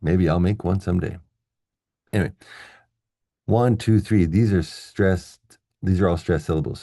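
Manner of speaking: ordinary talk, nothing marked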